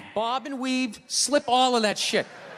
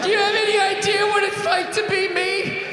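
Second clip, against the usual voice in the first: high, sing-song entitled whine; hammed-up self-pitying complaint; 6 semitones higher, 3 dB louder than usual. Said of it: "they're always bitching".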